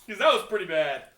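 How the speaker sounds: loud, excited imitation; loud mock reply. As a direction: blurt it out loud. Mocking the class response.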